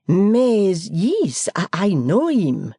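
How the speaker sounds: a Frenchman eagerly agreeing; French accent. Acted. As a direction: foreign guest answering the host in French accent. Foreigner answering.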